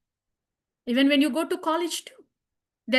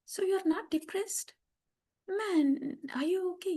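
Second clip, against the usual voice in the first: higher, softer voice of a puzzled friend; concerned friend; 4 semitones higher, 8 dB quieter than usual. Acted. Friends around ask after exam results, soft high concern. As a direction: ask softly, pitched up, checking on them. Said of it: "they will be like".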